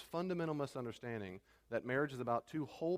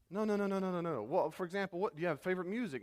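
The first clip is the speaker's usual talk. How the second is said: higher, insistent mock exasperation; quick, high-pitched protest, lightly exasperated; mounting frustration; 6 semitones higher than usual. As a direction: act out a suitor trying to get through. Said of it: "he's getting frustrated and frustrated".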